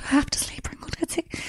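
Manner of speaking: whispered plea for a sleeping tablet; whispering to the night nurse for a sleeping tablet; pleading whisper. Retold or imitated. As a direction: beg for the pill in a whisper.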